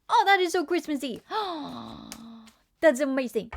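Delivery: high and loud gush over a cute emote; real delight; gleeful exclamation; excited gushing. Real, not acted.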